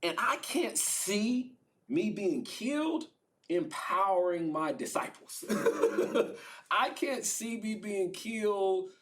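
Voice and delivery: high, laughing disbelief, slowed; can't make sense of it, half laughing; baffled Jesus. Acted. Jesus baffled by God's plan.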